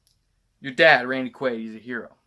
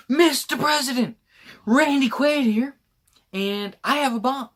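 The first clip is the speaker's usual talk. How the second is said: hammed-up hero; 10 semitones higher, 5 dB louder than usual. Drunk friend hams up pilot radioing the President. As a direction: call it in like a proud hero pilot. Voicing Randy Quaid's dramatic entrance.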